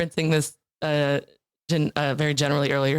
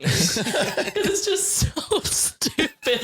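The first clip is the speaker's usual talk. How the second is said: words squeezed out through laughter, slowed right down; can barely get it out for laughing; laughing hard; 10 semitones higher, much slower than usual. Real, not acted. Telling the podcast hosts, laughing too hard to talk. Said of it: "I'm like crying".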